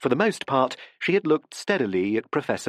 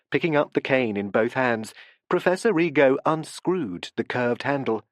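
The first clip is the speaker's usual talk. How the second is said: plain reading voice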